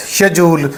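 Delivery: शेड्यूल as "she-JOOL" with a j sound; British schedule. Shown as a demonstration. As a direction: say शेड्यूल with sh at the start and j inside. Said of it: "जे की sound के साथ".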